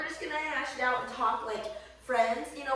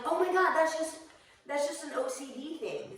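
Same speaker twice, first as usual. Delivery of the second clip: relieved realization; 6 semitones higher than usual. Retold or imitated.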